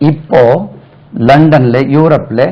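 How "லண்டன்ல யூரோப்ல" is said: ordinary delivery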